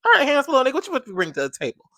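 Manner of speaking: high, loud, teasing sass, ending fast; sassy challenge. Retold or imitated. Sizing up the new handsome guy, high sassy tease. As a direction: size him up with sass. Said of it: "I was like".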